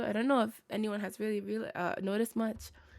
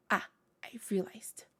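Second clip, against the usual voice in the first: hushed realization; 3 semitones lower, 5 dB quieter than usual. Real, not acted.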